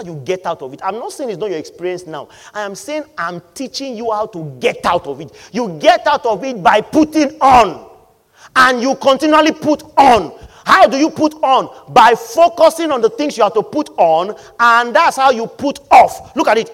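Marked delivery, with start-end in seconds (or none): 5.5-16.7 loud, forceful sermon delivery; preaching at full force. Acted. Preacher exhorting the church about attention, loud and forceful. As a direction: drive the point home to the congregation.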